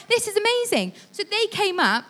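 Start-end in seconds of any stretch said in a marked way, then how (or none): 0.1-0.9 delighted. Retold or imitated. Delighted at rescue.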